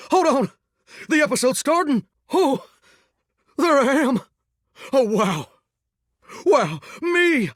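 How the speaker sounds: high, wide-eyed excitement; thrilled. Acted. Singer spotting their own episode on screen, thrilled. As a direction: gasp it out, high and awed.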